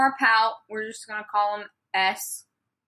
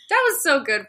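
ordinary talk

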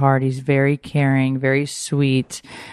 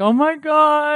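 moved gasp; 13 semitones higher, slower than usual. Retold or imitated. Tearful reaction to the note.

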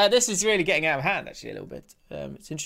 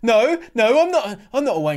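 high, loud pleading ref voice; hammed-up hurt referee, louder and higher; mock upset ref; 7 semitones higher, 6 dB louder than usual. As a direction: protest like a wounded referee. Playing the insulted ref.

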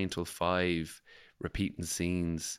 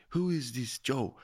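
slower, higher imitation of an Italian friend sounding Spanish; Spanish-sounding accent; 5 semitones higher, slower than usual. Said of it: "I don't know why she sounds Spanish".

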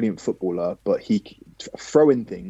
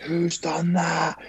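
put-on higher, slow Scottish accent; 8 semitones higher, 3 dB quieter, much slower than usual. Teammate hit by a biscuit, higher, slow Scottish accent. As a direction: call it out slowly in a Scottish accent. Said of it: "in his Scottish accent".

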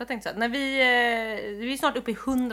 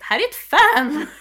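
retold shout; 4 semitones higher, 8 dB louder than usual. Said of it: "som Cissi skrek högt".

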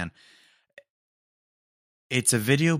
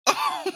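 sharp high burst of laughter; one last laugh that hurts. Real, not acted. Winding down from the video, one sharp high laugh. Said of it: "that hurt my throat laughing".

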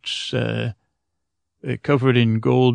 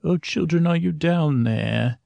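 kindly voice; 6 semitones higher than usual. A narrator's reading. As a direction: gently call the hidden children from the stairs. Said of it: "a kindly voice".

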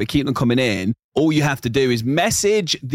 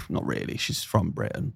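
quoting in a hushed whisper; breathy retold aside; 5 semitones lower, 9 dB quieter than usual. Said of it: "he actually went".

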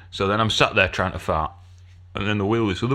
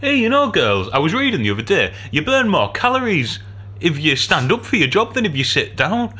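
high, bright-voiced gossipy nurse; mimicking the standing nurse's chirpy gossip; chatty nurse; 6 semitones higher than usual. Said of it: "stood up says".

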